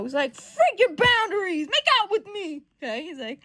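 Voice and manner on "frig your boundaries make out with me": high, loud, slowed mock demand; pushy outburst. Brushing off a friend's boundaries, pushy outburst. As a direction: throw it back forcefully, hammed-up. Acted rebuff of a boundaries request.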